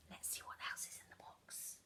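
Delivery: whispered, secretive; suspense whisper. Acted. Teacher whispering to children about the box.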